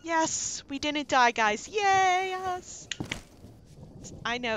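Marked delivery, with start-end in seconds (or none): 0.0-2.9 high, loud burst of relief; triumphant cheer. Real, not acted. Player telling viewers they survived the night, triumphant cheer. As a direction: whoop it up, stretching the last cheer.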